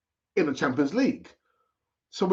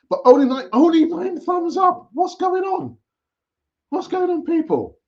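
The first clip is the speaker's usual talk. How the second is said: much higher, outraged disbelief at the viewers; mock outrage; 6 dB louder than usual. Real, not acted. Host pleading with viewers over few likes, high mock outrage. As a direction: squeal in mock shock at the thumbs-up count.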